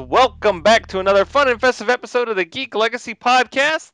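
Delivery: loud showman podcast intro; grand entrance announcement. Acted. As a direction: boom it out like a ringmaster.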